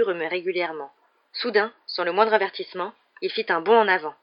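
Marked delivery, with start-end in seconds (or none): none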